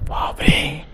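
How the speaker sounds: breathless whispered gasp of a word; scared whisper. Acted. Narrator whispering in fright at the gruesome scene. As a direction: hush it out in horror.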